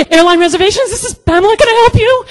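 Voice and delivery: very high, loud squeak; panicked, squeaky receptionist cover-up; flustered phone greeting. Retold or imitated. She covers panic answering phone.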